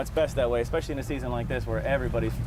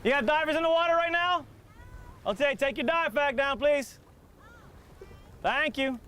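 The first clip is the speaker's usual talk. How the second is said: calling out; 13 semitones higher, 3 dB quieter than usual. Real, not acted. Calling across to boaters.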